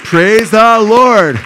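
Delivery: building to a long, high, loud shout; real shouted praise, stretching the words out as it climbs; jubilant praise. Real, not acted. Preacher rejoicing with the congregation that a baby was saved, jubilant.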